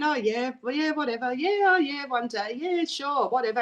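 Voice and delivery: mock bored agreement, lightly done; dismissive brush-off. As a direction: shrug off the suggestion. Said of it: "And I just kept going".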